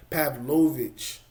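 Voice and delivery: slow, drawn "Pavlovich", every syllable leaned on; imitating his Pavlovich. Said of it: "why he says it like that".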